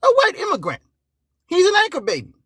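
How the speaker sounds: high mocking cry about the anchor baby; mocking outburst. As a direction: shriek it in disbelief.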